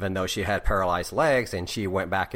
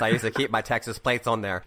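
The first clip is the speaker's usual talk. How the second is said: ordinary talk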